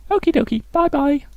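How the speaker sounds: very high, singsong falsetto; artist character. Acted. Artist seeing the farmer off, high falsetto. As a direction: chirp it in a high put-on voice.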